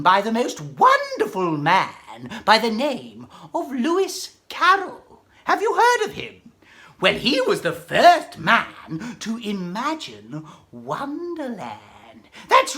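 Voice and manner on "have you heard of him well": ordinary delivery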